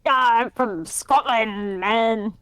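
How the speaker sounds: slow, lightly put-on Scottish voice; mock Scottish accent. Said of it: "That's what they sound like".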